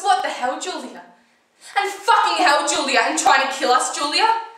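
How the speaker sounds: loud, high, alarmed outbursts; outraged yelling. Acted. Passengers cursing Julia after she swerves, outraged yelling. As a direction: yell at the driver in shock. Friends shout at reckless driver.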